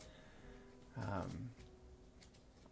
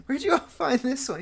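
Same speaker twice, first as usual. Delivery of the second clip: chuckling drawl; 12 semitones higher, 10 dB louder than usual. Acted. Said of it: "chuckles and goes".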